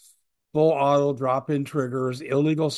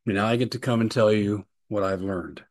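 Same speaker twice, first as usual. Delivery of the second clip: usual speaking voice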